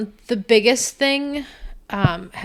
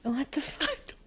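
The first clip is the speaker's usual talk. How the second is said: slow breathy whisper; 3 semitones higher, 15 dB quieter, slower than usual. Real, not acted. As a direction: hush it under your breath. Whispered aside.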